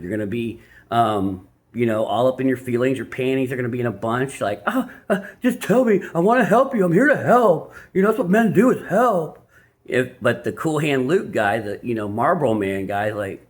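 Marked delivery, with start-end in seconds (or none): 4.7-9.4 higher, louder, overeager helper voice; mock eager helper. An insecure man eagerly begs to help his woman, higher and louder. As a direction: ham up the needy helper.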